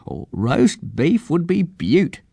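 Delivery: slow, high bird voice; delighted old eagle. A narrator's reading. Eagle accepts the offer of food, slow, high delight. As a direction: savour it in a high eccentric eagle voice.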